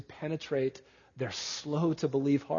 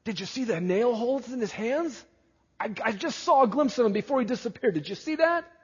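much higher, breathless amazed voice; excited disciple; 7 semitones higher than usual. Pretend. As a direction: play a wide-eyed follower gushing about a glimpse. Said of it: "What was on their minds?".

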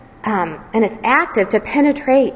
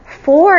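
"For" punched much higher and louder; stress on For; 9 semitones higher, 7 dB louder than usual. Shown as a demonstration. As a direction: hit "For" hard to spotlight it. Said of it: "is the explanation given".

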